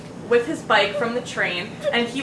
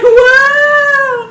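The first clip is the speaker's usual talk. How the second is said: high loud laughing cartoon whoa; 13 semitones higher, 12 dB louder than usual. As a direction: yell it like a cartoon character flying off a cliff. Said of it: "Like a cartoon".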